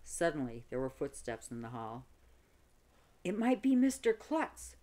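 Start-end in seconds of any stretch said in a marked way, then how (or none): 3.2-4.8 higher, louder alarmed yell; yelled warning. A narrator's reading. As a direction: warn the others in a panic. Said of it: "yelled Neil".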